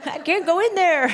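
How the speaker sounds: put-on high, trembling old woman laughing through it; anxious old woman. Voicing her anxiety as an old woman, high and laughing. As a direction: play a frightened old lady refusing to go in. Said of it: "I imagine her as an old woman".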